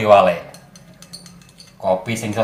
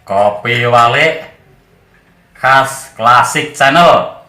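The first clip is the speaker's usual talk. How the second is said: slow loud tagline; 7 dB louder, much slower than usual. Acted. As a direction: draw out each word, loud. Channel tagline, drawn out.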